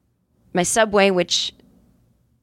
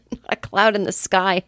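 ordinary talk, nothing marked

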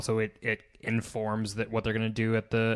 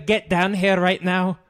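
put-on loud, higher Irish-accented call; 6 semitones higher, 5 dB louder than usual. Fan calling the player over in an Irish accent, loud. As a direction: yell it like an Irish fan in the stands. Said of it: "I can do Garrett Cooper".

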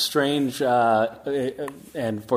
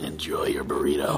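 deep solemn angel voice; Castiel voice; 12 semitones lower than usual. Shown as a demonstration.

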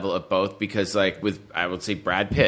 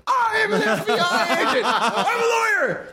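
Keanu Reeves screaming. Acted. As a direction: scream the job title like an over-the-top movie hero. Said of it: "Keanu Reeves screaming, like".

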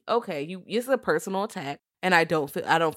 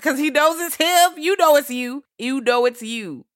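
loud accusing; 8 semitones higher, 7 dB louder than usual. Real, not acted. Accusing teasing.

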